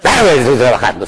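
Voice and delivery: hammed-up sullen worker; mock grouchy. Worker who thinks work must be hard, mock grouchy. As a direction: imitate a grump who takes work too seriously. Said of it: "además hay que ser jetón".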